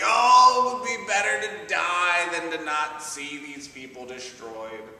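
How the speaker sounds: high, loud, whiny complaint; upset whining. Acted. Jonah complains to God about Nineveh, loud whiny wail. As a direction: wail it like a sulking prophet. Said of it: "He's so upset".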